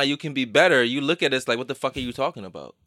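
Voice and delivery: ordinary delivery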